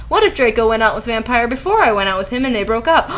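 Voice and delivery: plain reading voice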